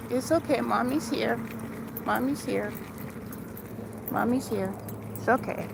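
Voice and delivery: calm the little one down softly. Real, not acted. Soothing her baby in stroller.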